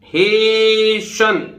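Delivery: हेशन drawn out very slowly, no t; silent t. Shown as a demonstration. Teacher modelling for students: slow हेशन, no t. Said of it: "हेस्ट से बना है हेशन".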